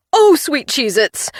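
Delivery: slow, loud frightened yelp. A narrator's reading. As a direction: yelp as the plane dips. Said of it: "I yelped".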